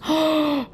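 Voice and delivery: high, audible gasp of awe; delighted gasp. Real, not acted. Seeing how the new block looks, an awed gasp. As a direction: gasp at something beautiful. Admiring the newly placed block.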